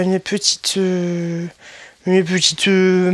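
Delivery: ordinary talk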